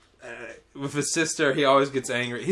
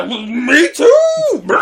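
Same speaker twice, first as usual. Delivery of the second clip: high, loud joyful yell; goofy little boy; 9 semitones higher, 7 dB louder, much slower than usual. Retold or imitated. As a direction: holler it like a kid joining the fun. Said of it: "the other goofy-eyed Chinese boy".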